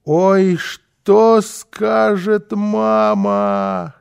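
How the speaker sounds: very slow, high, drawn-out moan; long storybook lament; despairing wail. A narrator's reading. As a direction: stretch every word in dismay. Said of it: "с отчаянием уставился".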